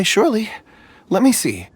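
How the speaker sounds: ordinary delivery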